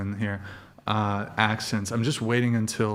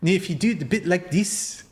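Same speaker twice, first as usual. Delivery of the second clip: higher, light attempt at a Kiwi accent; New Zealand accent; 6 semitones higher than usual. Streamer tries out a Kiwi accent, a little higher. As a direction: show how New Zealanders sound. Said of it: "Is that like".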